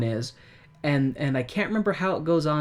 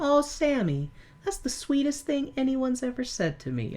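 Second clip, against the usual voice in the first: high, soft lovestruck sigh; swooning; 11 semitones higher, 4 dB quieter than usual. Retold or imitated. Linda swoons at Sammy's sweet line, high and soft. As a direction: melt at his words.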